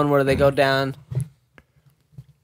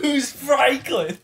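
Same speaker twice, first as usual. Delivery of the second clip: laughing; 10 semitones higher, much slower than usual. Acted. Mocking repeat of the question.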